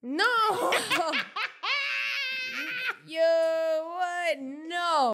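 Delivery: high, loud cries of disbelief through laughter; shocked laughing outburst. Real, not acted. Host reacting to an outrageous question, shouting and laughing. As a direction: holler it out, cracking up with real shock.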